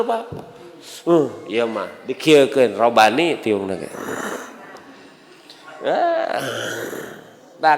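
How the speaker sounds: usual speaking voice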